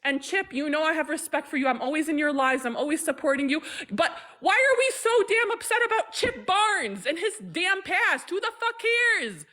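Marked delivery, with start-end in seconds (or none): 3.9-9.5 exasperated, pitched-up rant; angry outburst. Real, not acted. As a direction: voice jumps high in real anger, not yelling. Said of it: "I'm angry".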